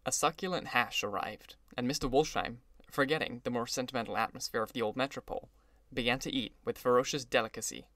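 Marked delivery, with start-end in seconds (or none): none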